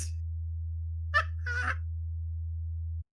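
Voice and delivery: helpless, high-pitched wheezing laughter; long high laugh. Real, not acted. Delighted by a friend's casting idea, breathless laughing. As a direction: laugh until there's no voice left.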